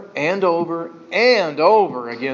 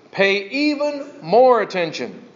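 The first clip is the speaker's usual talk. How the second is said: slow, with "even more" pitched up; stress on even more; 4 semitones higher than usual. Shown as a demonstration. Preacher quoting the Holman Bible, slow emphasis on "even more". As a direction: punch and stretch "even more". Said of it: "adverb adds the emphasis".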